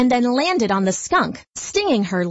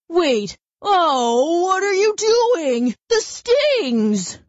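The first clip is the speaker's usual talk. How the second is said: rising, much higher panicky cries; panicking bear; 8 semitones higher, much slower than usual. Acted. Bear panicking as the bees attack, high and rising. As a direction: bear realising he's about to be stung. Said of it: "He panicked".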